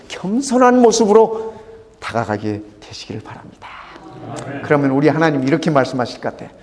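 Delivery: ordinary talk, nothing marked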